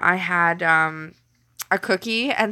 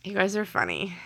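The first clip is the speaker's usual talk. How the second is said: normal talking voice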